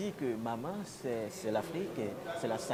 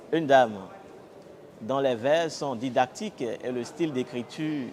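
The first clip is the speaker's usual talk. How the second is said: raised, projected presenter's opening; scripted show introduction; 2 semitones higher, 6 dB louder than usual. Acted. Presenter welcomes internet viewers and introduces the book's author, projected announcer delivery. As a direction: declaim the programme intro to the audience.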